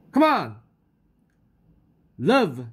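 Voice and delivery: calling out. Acted. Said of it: "called Daddy Wizard".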